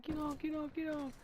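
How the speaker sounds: put-on very high childish squeal; squeaky mock-tearful pleading; imitated crying kid. Seven-year-old being bitten begs release, very high mock-crying voice. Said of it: "he starts crying".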